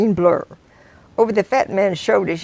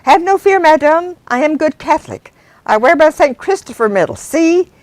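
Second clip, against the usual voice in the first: high, louder hearty reassurance; cheerful driver; 9 semitones higher, 4 dB louder than usual. Acted. Driver reassuring the scared passenger, loud and hearty. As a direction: boom it out like a proud believer. Driver boasts of his medal.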